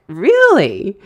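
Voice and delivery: re-enacted wide-open disbelief on "Really"; retold astonishment. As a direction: stretch "Really" in amazed disbelief. Repeated reply, more astonished.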